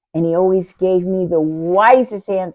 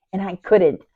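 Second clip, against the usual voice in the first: ordinary delivery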